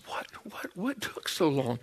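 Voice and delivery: wife's near-silent whisper, retold; hushed mock demand, barely voiced; imitated whispered impatience. Wife waiting outside the lab confronts her husband, in a hushed whisper. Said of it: "She's got this".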